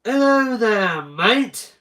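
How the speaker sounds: high, loud Australian greeting; hammed-up Australian, pitched high and swooping; Australian accent. Shown as a demonstration. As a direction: call out in a broad put-on Australian accent. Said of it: "That is my Australian accent".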